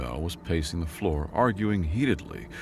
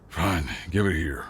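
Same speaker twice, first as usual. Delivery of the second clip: grumbling. A narrator's reading. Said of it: "he grumbled".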